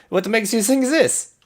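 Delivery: high, loud character voice; strained Eddie. Acted. Eddie snaps his hammer, then asks.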